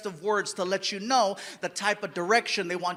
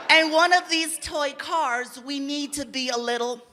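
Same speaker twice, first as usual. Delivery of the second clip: lightly voiced director. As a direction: explain it like a careful director. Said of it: "She's like".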